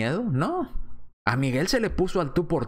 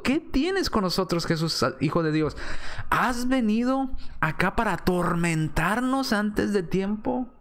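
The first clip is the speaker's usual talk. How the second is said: higher-pitched imitation of demons protesting; mild crying out; 6 semitones higher than usual. Preacher reading demons' words to Jesus, mild crying out. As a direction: quote the outcry emphatically, without shouting. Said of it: "Los demonios diciendo".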